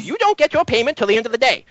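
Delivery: high, quick brush-off; dismissive professor. Acted. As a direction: wave her off like a stingy boss. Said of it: "He says".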